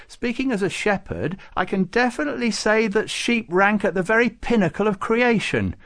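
high, loud Farouk-style character voice; eager saint, disputing. Acted. Farouk disputing with Babucar about sheep, insistent. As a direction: argue back, pitched right up.

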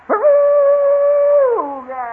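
very high, long drawn-out cry; jungle call. Shown as a demonstration. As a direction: wail it as a meeting call. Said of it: "use it for a meeting call".

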